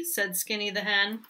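ordinary delivery, nothing marked

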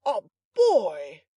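loud, blustering cry of discovery; valiant exclamation. A narrator's reading. Butler finding a boy at the door, valiant shout. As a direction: exclaim it with a show of valour. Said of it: "exclaimed Mister Giles valiantly".

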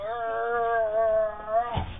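long, sustained playful growl; demonstrated effort noise. Shown as a demonstration. Trainer grabbing the dog up, drawn-out effort growl. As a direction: draw out an "arr" as you lift. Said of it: "You go".

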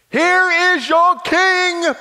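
loud, high, slow announcement; proclaiming. Acted. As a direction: present him to the crowd. Pilate presents Jesus to crowd.

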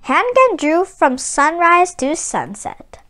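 calm storybook reading, evenly paced; story narration. A narrator's reading. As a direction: read it like a picture book to children. Reads the story aloud.

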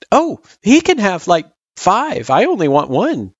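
put-on high kid voice, said quickly. A narrator's reading. Frisk giving away the candy, quick. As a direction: blurt it out as a generous child. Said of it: "she said quickly".

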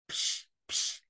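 soft voiceless "psh" puffs; imitated snoring puffs. A narrator's reading. Animals hearing a sound under the bed, hushed puffs. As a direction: puff out two hushed little snores. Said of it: "snoring softly".

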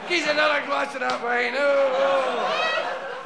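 put-on loud drunken demand for wine; drunken call. Drunk apostle calls for wine.

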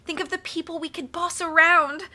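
high, rising villainous relish, slowing at the end; gloating excitement. A narrator's reading. Esme urging Olaf to use the fungus, gloating excitement. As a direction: tempt him with dreams of power.

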